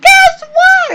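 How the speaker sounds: high, loud "Guess what?"; excited announcement. Retold or imitated. Calling her husband with the baby news, thrilled. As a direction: blurt the big news.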